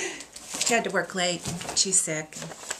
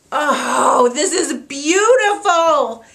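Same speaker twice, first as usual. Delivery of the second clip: tearful cry; 5 semitones higher, 13 dB louder, much slower than usual. Real, not acted. Seeing the quilt, crying.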